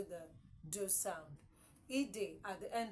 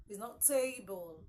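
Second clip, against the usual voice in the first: ordinary delivery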